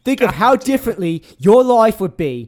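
loud mock Cockney Michael Caine quote. Quoting a corporate mentalist in a Cockney Michael Caine voice. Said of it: "Michael Caine it up".

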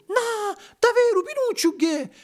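very high puppet squeal of disbelief; high falsetto puppet cry; Purginella crying out. A narrator's reading. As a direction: squeal the question like an amazed puppet. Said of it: "gridò Purginella".

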